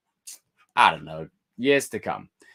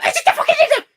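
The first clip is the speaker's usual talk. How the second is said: much higher, louder squawk with a laugh; mock keyboard warrior; 11 dB louder than usual. Mimicking a toxic Twitter user, shrill and loud. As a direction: shriek it like an online troll. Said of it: "people who go".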